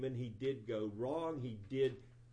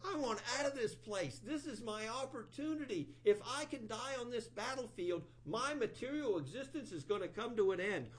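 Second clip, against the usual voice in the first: higher, pleading demon begging to be killed; 9 semitones higher than usual. Retold or imitated. Vritrasura pleading with Indra to kill him, high and urgent. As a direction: beg him to finish you off. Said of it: "He had to really preach to Indra".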